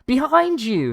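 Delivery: very high loud cry; warning shout. Retold or imitated. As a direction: shout a warning across the bar.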